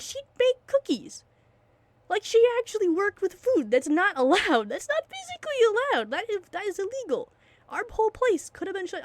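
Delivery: real outrage, voice climbing high; worked-up rant. Real, not acted. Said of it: "I don't know why I'm talking like this".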